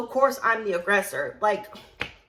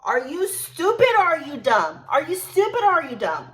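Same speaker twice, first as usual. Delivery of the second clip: loud, higher challenge; acted outrage; 4 semitones higher, 5 dB louder than usual. Insulted server confronts coworker, outraged. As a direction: throw it at them, indignant and raised. Re-enacted quarrel after being insulted.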